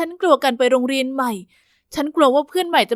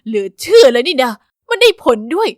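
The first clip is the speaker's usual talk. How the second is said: amazed; 8 dB louder than usual. Acted. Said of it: "ครูมิวก็ตะลึงไปเลย".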